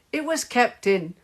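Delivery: high, loud objection; protesting. A narrator's reading. Henry disputing the call, loud protest. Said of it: "protested Henry".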